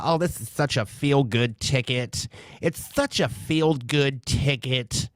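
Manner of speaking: put-on gushing voter voice; mocking gush. Mocking voters on social media, put-on gushing. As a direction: mimic a smitten supporter, drawing out the repeat.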